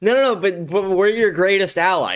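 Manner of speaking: hammed-up whine of a fake friend; mocking. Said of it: "it's like".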